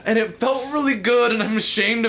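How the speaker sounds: giggling as the story gets worse; half-laughing, embarrassed confession. Real, not acted. Laughing while telling embarrassing story.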